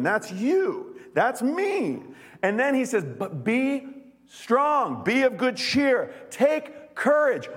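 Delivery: rally the room; preaching at full force. Real, not acted. Pastor rallying the church to take courage, fervent.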